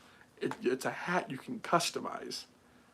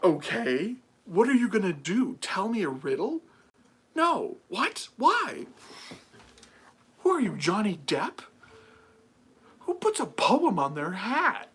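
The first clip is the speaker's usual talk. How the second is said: loud, high-pitched disbelief; hammed-up baffled questions, pitch leaping up; mock incredulous; 7 semitones higher, 6 dB louder than usual. Streamer scoffing at poems on hats, loud and incredulous.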